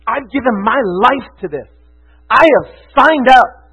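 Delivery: passionate. Real, not acted. Preacher declaring a life given to the gospel, passionate. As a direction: punch it out with full conviction. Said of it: "I'm passionate about this".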